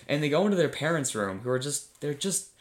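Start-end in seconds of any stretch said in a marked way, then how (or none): none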